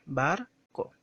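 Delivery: "Barco" split in two, with an unforced "co"; "Barco" in two beats, the second weaker; unstressed second syllable. Shown as a demonstration. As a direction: pause after "Bar", then let "co" fall naturally without force. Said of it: "se pronuncia sin fuerza de voz".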